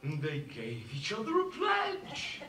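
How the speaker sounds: low, soft, to himself; stunned disbelief. Acted. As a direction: repeat it under your breath in shock. Father repeats it in shock.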